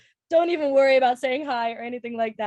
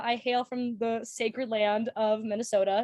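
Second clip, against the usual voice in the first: usual speaking voice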